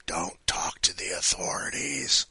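hush, a mock warning under the breath; mock secret whisper. Host whispers a joke warning to listeners, conspiratorial whisper.

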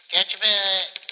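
high, loud cooing. Real, not acted. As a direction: squeal it at the cat. Baby talk to her cat.

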